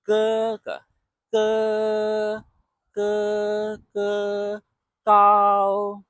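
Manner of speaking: Ge ge ge ge gao all on one high steady pitch; 一直高平; held tone one. Shown as a demonstration. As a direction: keep every ge and gao high and level. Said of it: "a continuous tone, high and steady".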